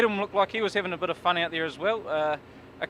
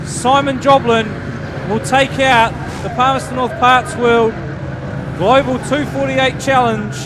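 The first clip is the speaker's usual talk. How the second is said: higher, louder excited finish call; motorsport commentator; 6 semitones higher, 9 dB louder than usual. Acted. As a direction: bring the race home to the flag. Calls the race finish.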